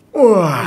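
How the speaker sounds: loud open wah roar; all-out lion face roar; full lion roar. Shown as a demonstration. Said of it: "harus bener-bener".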